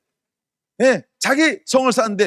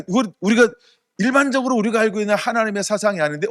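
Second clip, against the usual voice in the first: ordinary talk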